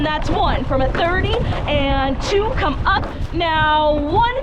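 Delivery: rising, driving stroke count; rowing coxswain calls. Real, not acted. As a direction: push the crew through the piece.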